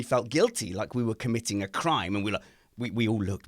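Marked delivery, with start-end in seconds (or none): none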